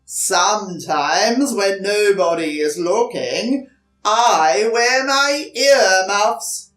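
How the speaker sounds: lion confessing he wears earmuffs; booming lion. Acted. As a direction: boom it out, then confide. The lion confides its fear.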